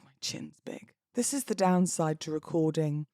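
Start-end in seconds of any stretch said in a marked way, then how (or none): 0.0-0.9 whispered aside. Real, not acted. Aside about her own video.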